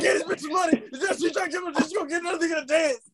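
high, wheezing laugh through the words; can barely get it out for laughing; laughing hard. Real, not acted. Retelling his friend's fit, cracking up laughing. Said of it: "I'm, like, crying in the strip club".

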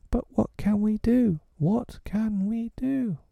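high frantic question; panicked boys. A narrator's reading. The lost boys panicking as wolves close in. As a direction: fret in panic.

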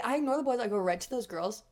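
ordinary talk, nothing marked

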